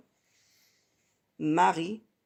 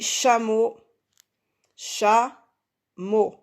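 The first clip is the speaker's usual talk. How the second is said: CHAMEAU whole, then CHA and MOT apart; split into syllables; 2 semitones higher, 3 dB louder, much slower than usual. Shown as a demonstration. Teacher showing two syllables to pupils, split into syllables. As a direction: separate "cha" and "mot" with a clear pause. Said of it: "là, j'ai deux syllabes".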